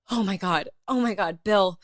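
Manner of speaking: loud mock-overwhelmed outburst; imitating mom freaking out. Daughter imitates mom meeting a comedian backstage, loud freak-out. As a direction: gush as if losing it in front of a star. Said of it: "she loses her fucking shit".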